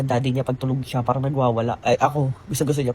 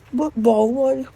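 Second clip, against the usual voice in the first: put-on high, louder whimpering toddler stammer; pitiful child voice; 11 semitones higher, 4 dB louder, much slower than usual. Toddler repeating the steward's no, high pleading mock whimper. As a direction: play the child begging for pity. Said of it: "parang nagpapaawa siya".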